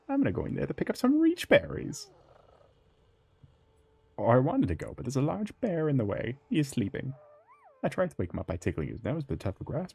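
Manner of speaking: high, chirpy little critter voice; lightly voiced sweet forest creature; cute game character voice. Reads game NPC dialogue.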